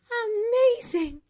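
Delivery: high, loud, drawn-out delight; ecstatic. A narrator's reading. Girl answers how walking feels, ecstatic. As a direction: stretch it out, thrilled.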